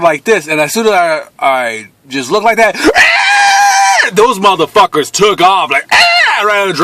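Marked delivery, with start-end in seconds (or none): 2.8-4.1 high shrieking laugh. Real, not acted. Watching the robbers flee, high shrieking laugh. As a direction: burst into a wild shriek of laughter.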